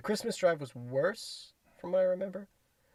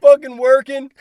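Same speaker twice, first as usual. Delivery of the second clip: high, loud holler back; mock shouted answer; 12 semitones higher, 16 dB louder than usual. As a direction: yell the excuse back across the lot.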